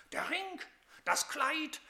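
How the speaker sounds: mild anger. Acted. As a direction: spit out each gift in grievance. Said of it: "Wut kochte hoch dem Sohn".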